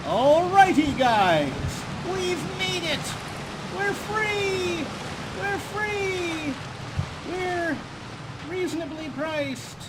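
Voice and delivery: jubilant, shouted celebration; triumphant shouting. Real, not acted. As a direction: yell it like you just escaped. Celebrating climbing out.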